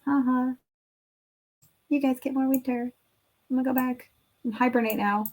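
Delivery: playful pretend taunt with a fake ha-ha; mock gloating groundhog. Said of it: "He's probably out there laughing".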